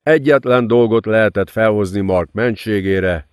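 plain reading voice

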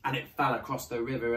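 ordinary delivery

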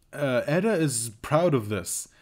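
ordinary talk

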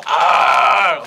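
loud puppet roar; angry dinosaur roar. Acted. Puppet dinosaur roaring at creatures, loud angry roar. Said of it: "a very angry dinosaur".

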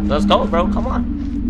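loud high hype; hyped shout. Real, not acted. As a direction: celebrate the escape. Hyped at chapter end.

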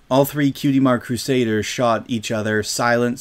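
ordinary delivery

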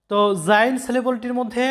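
ordinary delivery

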